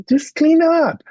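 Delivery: high, loud mock outburst; exasperated order. Teacher ordering crying boy to tidy up, high exasperated yell. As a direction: re-enact a teacher losing patience.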